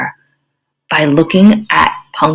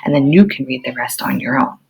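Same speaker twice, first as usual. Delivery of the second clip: ordinary talk, nothing marked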